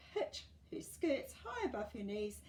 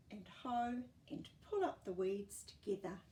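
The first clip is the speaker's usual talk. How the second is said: usual speaking voice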